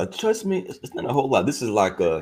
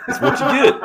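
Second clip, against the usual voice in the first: laughing; much faster than usual. Real, not acted. Laughing while replaying the clip.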